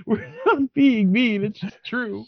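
laughing while talking. Real, not acted. Teasing friend while laughing.

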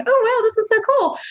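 high, bright gush of delight; excited. Retold or imitated. Telling how the business took off, high excited gush. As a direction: squeal it like great news. Retelling her own excited reaction.

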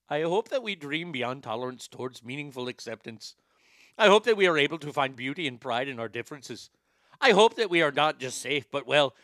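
mock Indian accent drifting towards Irish; wobbly Indian-turned-Irish accent; slipping Indian accent. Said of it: "I'm starting to slip into Irish there".

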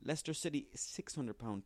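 normal talking voice

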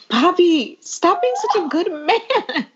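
high, slow, mock-pleading swoon; swooning plea. Fan talking to the character Poppy, high slow swooning plea. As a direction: beg him to stop being so perfect. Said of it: "and I'm like".

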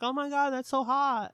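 high-pitched mock swooning; mock gushing. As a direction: gush like an impressed admirer. Acting her gushing over the rock.

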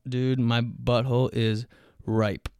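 ordinary delivery

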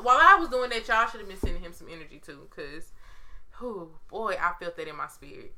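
ordinary delivery

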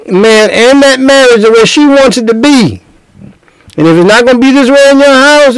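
preaching. Acted. Preacher tells the congregation how it's gonna be, preaching hard. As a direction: drive the point home from the pulpit.

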